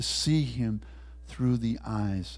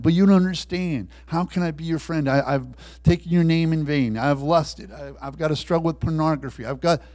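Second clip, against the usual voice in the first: raised, higher, stumbling objection; anguished protest; 5 semitones higher, 6 dB louder than usual. Acted. Sinner protests to Christ that he is unworthy, agitated. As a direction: pile up confessions as objections, voice lifted.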